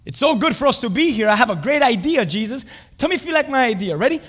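loud, high-pitched eager pitch; Peter. Acted. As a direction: pitch your great idea with bursting enthusiasm. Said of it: "Of course, Peter's gonna say that".

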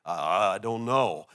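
low, slow, drawn-out mumbled answer; bewildered acted reply. Singer kneeling before the pastor answers why he came, low and slow. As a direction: drag it out low, as if at a loss.

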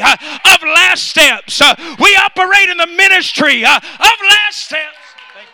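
loud, high whooping preacher cadence with a huh after each phrase; preaching at full force. Acted. Pastor roaring to the congregation at full force. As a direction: build it to a roaring climax.

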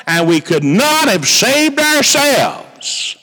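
high, slow, solemn preaching. Acted. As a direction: proclaim it slowly from the pulpit. Preaching opening of sermon.

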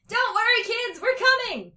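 full-volume high yell. A narrator's reading. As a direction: holler reassurance to someone far away. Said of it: "yelled Dee Dee".

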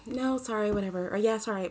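very soft, backing-down mumble; meek apology. Retold or imitated. How a normal person would answer a challenge, meekly apologetic. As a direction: imitate a normal person shrinking from conflict. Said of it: "a normal person would be like".

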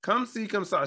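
high, singsong teacher imitation; imitating French teacher. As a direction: mimic your French teacher's pet phrase, pitched high. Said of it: "She would always be like".

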